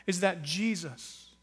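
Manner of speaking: ordinary talk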